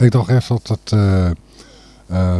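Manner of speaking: ordinary talk, nothing marked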